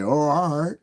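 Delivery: high, rising-voiced mock approval; retold impressed reaction. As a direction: act out being pleasantly impressed.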